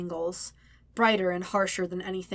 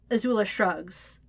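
plain reading voice

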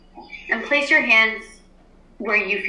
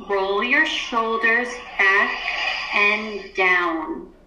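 guided meditation. Acted. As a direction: ease the class into stillness. Teacher leads class meditation.

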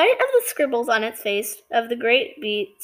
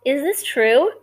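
ordinary delivery, nothing marked

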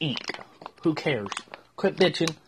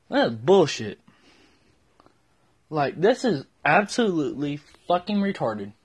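ordinary delivery

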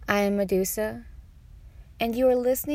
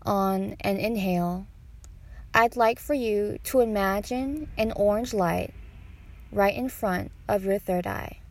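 guided meditation; 4 dB quieter than usual. Acted. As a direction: guide them unhurriedly through each breath.

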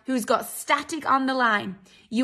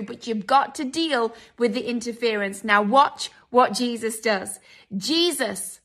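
ordinary delivery

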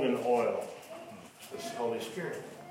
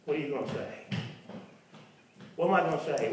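normal talking voice

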